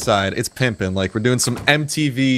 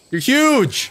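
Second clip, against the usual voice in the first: playful fake awe; mock shock; 14 semitones higher than usual. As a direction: act stunned by the size. Mock shock at the big dino.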